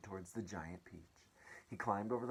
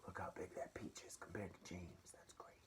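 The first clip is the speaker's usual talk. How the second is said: whispered aside; 11 dB quieter than usual. Real, not acted. Aside about the picture.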